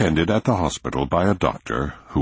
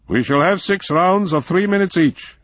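formal student voice; 4 dB louder than usual. A narrator's reading. Formal duel rules announced.

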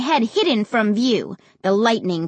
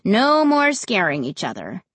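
usual speaking voice

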